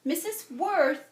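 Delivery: put-on girl calling the teacher across the room; crying out. A narrator's reading. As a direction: call out to the teacher for help. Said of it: "Jamaica cried".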